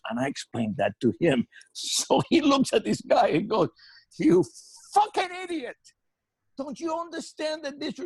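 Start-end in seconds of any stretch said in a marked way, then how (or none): 4.2-8.0 high furious bark of a captain chewing him out; captain berating the cook in a raised fury; angry tirade. Retold or imitated. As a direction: tear into him, much higher than usual. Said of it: "lambasting this guy".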